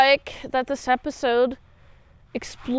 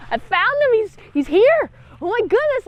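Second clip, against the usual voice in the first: high, louder thrilled whisper-turned-cry; hammed-up glee, higher and louder; mock excited discovery; 8 semitones higher, 6 dB louder than usual. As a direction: squeal over finding him. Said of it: "She's like".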